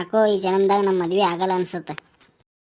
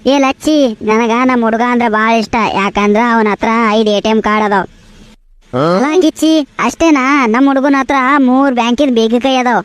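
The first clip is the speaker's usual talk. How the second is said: put-on much louder, showing off about boyfriends; loud bragging; 9 dB louder than usual. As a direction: brag loudly to your friend. Two girls bragging loudly. (ಜೋರಾಗಿ ಬಡಾಯಿ ಕೊಚ್ಚುವುದು)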